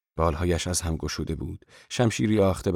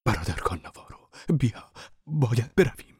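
unvoiced, urgent whisper; frightened monk's hushed plea; trembling whisper; 4 semitones higher than usual. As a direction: hush it out as a frightened monk. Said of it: "با صدایی لرزان زیر لب".

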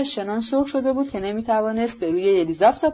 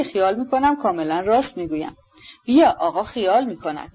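ordinary delivery, nothing marked

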